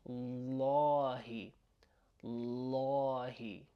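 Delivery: lahi, slow, first vowel like "aw"; heavy la. Shown as a demonstration. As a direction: draw out "lahi" with a dark, full l and a. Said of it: "the difference the way I'm saying it".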